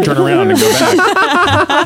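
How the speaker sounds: high, slow laughing put-on voice; sing-song taunt through a laugh; laughing mock dismissal; mock scold. Mock telling her off.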